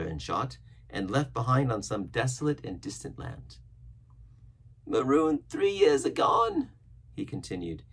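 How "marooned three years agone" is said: put-on slow, high, loud old-sailor voice; marooned castaway, lamenting. A narrator's reading. Castaway telling of three years alone, lamenting.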